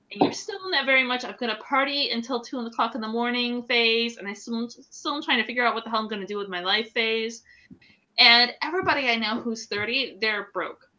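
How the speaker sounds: usual speaking voice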